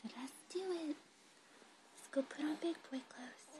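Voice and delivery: high and hushed coaxing. Real, not acted. Same coaxing repeated very softly.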